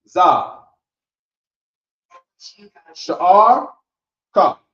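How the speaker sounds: "Za", "Sha'ar", "Ka" each called out loud and apart; teacher calls the sounds loudly one at a time; slow drill. Shown as a demonstration. Teacher drilling the student on each word, loud. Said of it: "Right, ready?".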